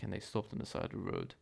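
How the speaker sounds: ordinary delivery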